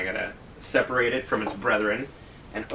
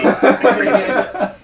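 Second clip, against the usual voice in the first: slow, laughing, much higher and louder; laughing, drawn out; 10 semitones higher, 8 dB louder, much slower than usual. Shown as a demonstration. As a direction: stretch it out while cracking up. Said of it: "the crisis in the city".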